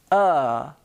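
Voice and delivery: "uh" as a neutral unstressed vowel; a simple relaxed "uh"; schwa sound. Shown as a demonstration. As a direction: hold "uh" on a breath out. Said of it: "the schwa sound is".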